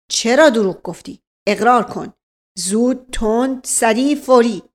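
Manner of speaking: loud, rapid-fire interrogator; bully him with questions; barking police officer. Acted. Officer grilling the old servant, harsh and loud. Nayeb interrogates Mash Qasem.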